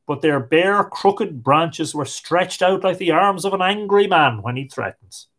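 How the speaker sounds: ordinary delivery, nothing marked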